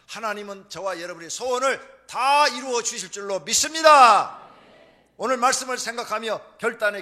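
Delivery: loud, high closing declaration; rally the congregation loudly toward prayer; full-force preaching. Real, not acted. Preacher calling congregation to pray in resolve, forceful preaching.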